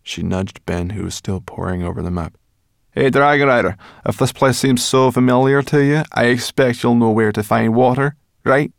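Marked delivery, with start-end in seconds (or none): 2.9-8.7 high, loud taunting voice; brash character voice, mocking; Sorrel, needling companion. A narrator's reading. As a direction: goad them with a pointed challenge.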